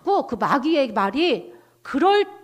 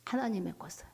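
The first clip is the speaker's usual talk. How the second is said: ordinary delivery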